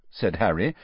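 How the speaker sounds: ordinary delivery, nothing marked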